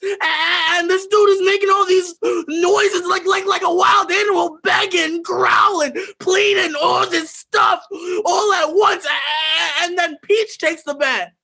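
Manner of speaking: put-on teen confessing the beating, frantic shout; frantic retelling. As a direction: spill it out, spiralling out of control. Retelling beating, rising frenzy.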